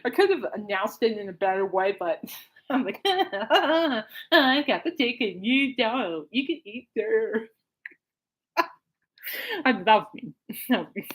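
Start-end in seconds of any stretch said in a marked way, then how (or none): 3.1-7.5 drawn-out, teasing singsong; gloating singsong taunt. Retold or imitated. Re-enacting the announcement to sister, gloating singsong. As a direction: stretch each phrase to rub it in.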